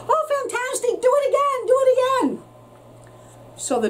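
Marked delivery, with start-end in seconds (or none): 0.1-2.4 very high, eager cheering shout. A narrator's reading. Princess urging the exhausted dragon on, very high cheering shout. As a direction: egg him on like a thrilled fan. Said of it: "but Elizabeth shouted".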